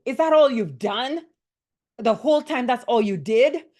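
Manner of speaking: loud, high, scornful demo of a bully; bully yelling. Shown as a demonstration. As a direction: yell it down at the worker like a bully boss. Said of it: "Let's say I'm the bully and I yell at you".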